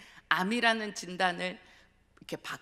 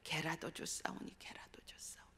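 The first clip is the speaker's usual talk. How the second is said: fading hushed repetition, dropping to almost nothing; echoing whisper; 2 semitones lower, 12 dB quieter than usual. Retold or imitated. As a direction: let it echo in your head, softer each time. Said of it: "제 머리에 맴맴맴 하는 건".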